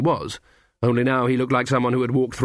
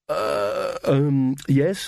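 flustered stalling; 3 semitones higher, much slower than usual. A narrator's reading. Magrat caught out about the christening, hesitant and awkward.

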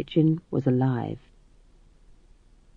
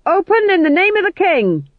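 sergeant bellowing much higher and louder; shouted command; 12 semitones higher, 6 dB louder than usual. A narrator's reading. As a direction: roar it at the door. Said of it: "and a voice cried".

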